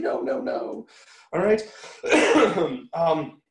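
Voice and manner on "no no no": teasing nurse. Retold or imitated. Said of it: "the nurse is saying".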